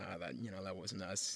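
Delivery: soft, low, sheepish brush-off; downplayed denial. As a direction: play it down quietly to the cop. Acted denial to police officer.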